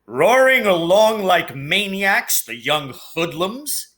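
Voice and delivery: slow, disgusted grumble at reckless bikers; grumbling Uncle Vernon. Acted. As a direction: scoff at the young hoodlums.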